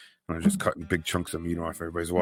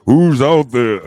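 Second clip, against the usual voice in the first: loud, raised call into the dark; 6 semitones higher, 13 dB louder, much slower than usual. Retold or imitated. Freaky porch owner calling at intruders, loud call. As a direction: holler it from the porch at strangers. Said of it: "and he's like".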